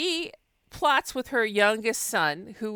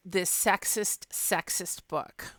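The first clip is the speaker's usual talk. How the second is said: disapproving hush; 2 semitones lower, 6 dB quieter, slower than usual. Real, not acted. Mock disapproval of sexist book.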